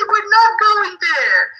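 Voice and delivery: high, slow, drawn-out mock announcement; parent's teasing letdown. Teacher plays parent dashing kids' hopes, teasingly drawn out. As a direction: stretch out the playful letdown. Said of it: "And you say".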